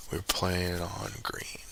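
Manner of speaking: slow whispered bet; whispering. Real, not acted. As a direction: whisper it slowly.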